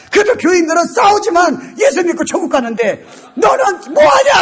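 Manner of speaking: high-pitched shouted challenge; confrontational retort. Shown as a demonstration. Preacher acting a rebuttal to critics, mock scolding shout. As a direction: build to a blasted final question.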